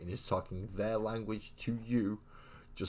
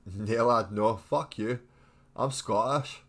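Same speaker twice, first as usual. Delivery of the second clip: put-on Scottish accent; 4 semitones higher, 9 dB louder, slower than usual. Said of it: "do a better Irish accent".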